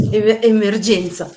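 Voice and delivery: laughing loud alarm; loud exclamation. Real, not acted. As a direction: call out the emergency. Laughing alarm during stream.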